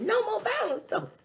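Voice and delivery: high, loud insistence; loud mock protest. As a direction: shout it up high.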